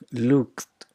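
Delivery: "looked" as one syllable, lookt. Shown as a demonstration. Teacher giving an example of the T sound, "looked" as lookt. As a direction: finish "looked" on a released T. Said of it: "a T, a T sound".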